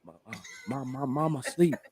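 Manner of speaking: put-on hushed, secretive little-kid whisper; whispering child. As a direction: keep it very low, imitating a whispering grandchild. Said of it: "he's whispering".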